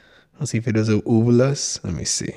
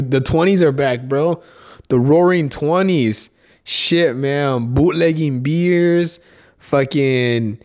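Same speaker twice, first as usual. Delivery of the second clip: usual speaking voice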